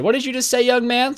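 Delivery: high-pitched mock indignation; offended old woman. Old customer challenging the bagger, high mock outrage.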